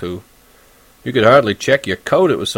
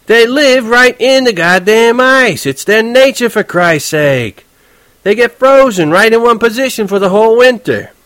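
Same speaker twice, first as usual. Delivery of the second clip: much higher, louder, heated; worked up; 11 semitones higher, 5 dB louder than usual. Acted. Horwitz getting riled up while driving. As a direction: fire it back, all riled up. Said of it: "He got so damn excited".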